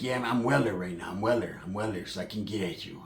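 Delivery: low, soft, heavy imitation of Pepe; serious Pepe. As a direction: mumble it low like a tired gangster. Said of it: "he was being serio".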